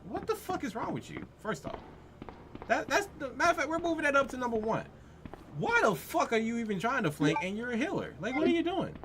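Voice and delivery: high exasperated scolding; angry rant. Real, not acted. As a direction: throw up your hands at him. Ranting at a flanking healer.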